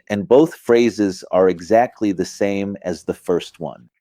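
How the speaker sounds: normal talking voice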